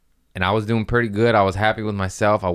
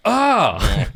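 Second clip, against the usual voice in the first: teammate's shock; 12 semitones higher, 5 dB louder than usual. Retold or imitated. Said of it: "and they went".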